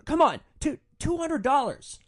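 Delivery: high, loud mock begging; loud, high-pitched insistent coaxing; incredulous pleading. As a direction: imitate a drunk man who can't believe he's refused. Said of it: "cannot believe that I won't do it".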